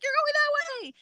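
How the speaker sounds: high, sing-out crowd call; directing call. Retold or imitated. Everybody pointing her to the track, high cheery call. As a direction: call directions high, without extra volume.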